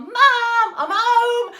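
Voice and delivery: high, loud call through the house; shout. Acted. Jack shouting to his mum as he comes in. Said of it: "he shouted to his mum".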